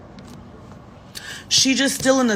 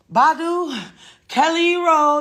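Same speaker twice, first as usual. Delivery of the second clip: imitating [name] calling names; 8 semitones higher, 4 dB louder, slower than usual. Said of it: "she'll be like".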